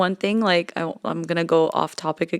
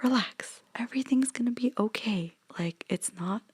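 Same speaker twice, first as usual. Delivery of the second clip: whispered advice; 5 semitones higher than usual. Retold or imitated. Telling a stressed friend to chill, in a whisper. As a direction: hush them like a friend saying relax.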